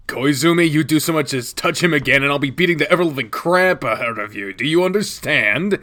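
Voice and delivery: Shuichi's loud, cold warning; angry threat. Acted. Shuichi warns Koizumi to never touch him again, loud and angry. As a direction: threaten him, each word a promise.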